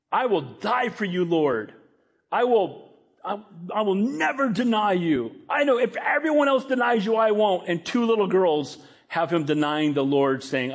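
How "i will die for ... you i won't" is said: high-pitched, emphatic pledge; fervent vow. Acted. Peter vows loyalty to Jesus, fervent pledge. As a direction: swear loyalty with rising insistence.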